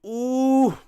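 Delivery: a sudden high ooh of surprise; real gasp-like ooh at the miss; surprised ooh. Real, not acted. Reacting live to a missed shot.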